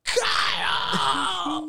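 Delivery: long, high, loud holler; mimicking Dad yelling for his son; screaming dad. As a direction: scream it over a roaring snowblower. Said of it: "He's screaming at the top of his lungs".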